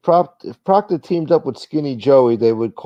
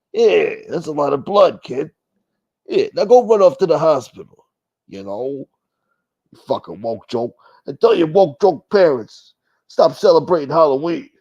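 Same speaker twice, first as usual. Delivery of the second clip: mock menacing creep; 3 semitones higher, 3 dB louder than usual. Creep handing a trick-or-treater a razor apple, mock menace. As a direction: taunt the kid like a horror villain. Acting out a menacing candy giver.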